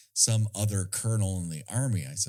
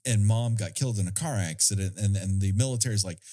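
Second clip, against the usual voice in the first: ordinary talk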